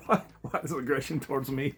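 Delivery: mock-wounded protest through laughter; laughing protest. Man cussed at by woken girl, laughing protest. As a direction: crack up while protesting. Laughing as he retells her cursing.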